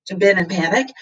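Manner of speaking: ordinary delivery, nothing marked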